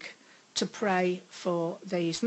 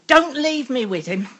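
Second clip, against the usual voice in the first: loud, high, desperate retold scream; screamed plea; 5 semitones higher, 10 dB louder than usual. Panicked woman pleading with the rector's wife, loud scream. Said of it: "I screamed at this".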